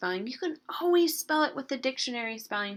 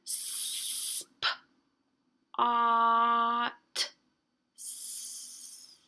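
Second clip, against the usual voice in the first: super slow. Shown as a demonstration. Teacher showing easier way to hear letters, super slow. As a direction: pull "spots" apart, long S at each end. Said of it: "That's saying it super slow".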